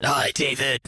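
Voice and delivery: bad attempt at a gruff noir voice; half-hearted tough-guy growl; hard-boiled voice. Shown as a demonstration. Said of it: "my really bad attempt at a hard-boiled voice".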